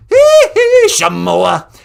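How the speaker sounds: very high, loud falsetto vocal hiccups. Acted. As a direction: squeal a pop star's signature yelps.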